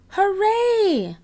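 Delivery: high joyful cheer; cheering hooray. Acted. Children celebrating Peppa's prize, cheering high. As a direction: cheer with delight. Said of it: "shout the children".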